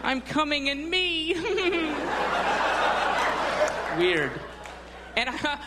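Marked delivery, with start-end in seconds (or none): 0.0-1.3 mock ecstatic moan. Gym guys loving their reflection. 1.4-3.9 can't hold back the laugh; real laughing fit. Real, not acted. Laughing at own bit.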